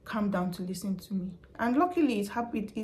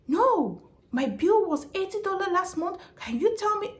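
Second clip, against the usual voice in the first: mimicking an angry customer; 10 semitones higher than usual. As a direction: squeal like an outraged caller. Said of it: "He does not want me to talk".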